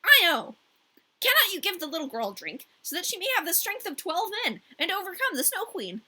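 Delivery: reindeer voice. A narrator's reading. Reindeer pleads for Gerda.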